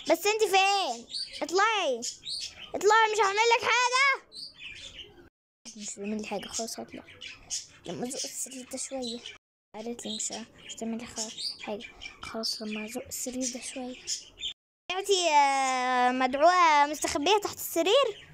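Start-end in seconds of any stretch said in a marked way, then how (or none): 5.8-14.0 much softer hushed muttering. Acted. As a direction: talk under your breath while hiding. Hiding girl muttering to herself. (بتتمتم بصوت واطي جدا)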